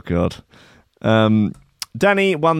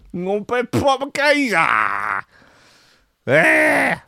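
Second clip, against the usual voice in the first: Danny Dyer impression; 2 semitones higher than usual. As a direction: lecture like a swaggering East End hard man. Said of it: "That was, uh, Danny Dyer there".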